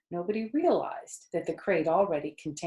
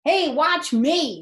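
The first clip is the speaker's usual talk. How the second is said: loud, slow, high show-off yell; 8 semitones higher, 8 dB louder, slower than usual. A narrator's reading. Avery yelling as he crawls into the crate. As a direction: shout for attention. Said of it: "yelled Avery".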